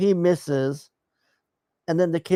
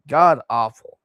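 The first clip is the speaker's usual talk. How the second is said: normal talking voice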